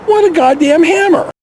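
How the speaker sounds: normal talking voice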